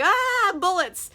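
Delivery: high little mock scream; mock fright. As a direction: pretend to flee in a comic panic. Pirates flee the gunfire.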